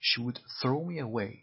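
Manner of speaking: "throw" punched, slow careful phrase; stress on throw. Shown as a demonstration. Teacher showing learners the stress on "throw". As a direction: lean hard on "throw". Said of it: "استرسش روی کلمهٔ throw".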